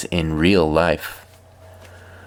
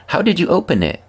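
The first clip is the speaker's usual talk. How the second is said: put-on child voice; 10 semitones higher, faster than usual. Nephew quoted asking.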